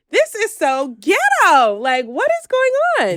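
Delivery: loud, high disbelief; exasperated shout. Retold or imitated. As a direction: shout it in outrage. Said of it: "I'm like".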